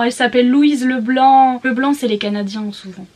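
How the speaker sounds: ordinary delivery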